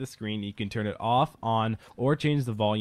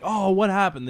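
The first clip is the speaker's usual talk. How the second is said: higher-pitched mock surprise; startled classmates; 9 semitones higher, 4 dB louder than usual. Classmates react to the projector switching off, mock surprised. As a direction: act out a bewildered class.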